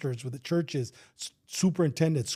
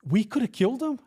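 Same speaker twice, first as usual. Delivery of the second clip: higher, questioning echo; incredulous echo; 6 semitones higher than usual. Retold or imitated. Officer answers the same cop, incredulous echo. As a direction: throw the words back as a disbelieving question. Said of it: "I go".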